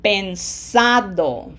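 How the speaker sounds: pensado with a long, emphasised SA; slow pensado, A of -ado stressed. Shown as a demonstration. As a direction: lean on the middle syllable: pen-SA-do. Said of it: "The A gets pronunciation emphasis".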